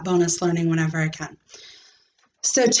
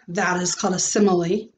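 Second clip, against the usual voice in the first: ordinary delivery, nothing marked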